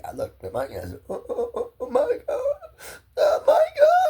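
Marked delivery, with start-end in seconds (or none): 1.1-4.1 high, loud wailing oh my God; retold crying. A small boy seeing his brother hurt, crying out. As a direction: relive the childhood panic in tears. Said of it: "I started crying".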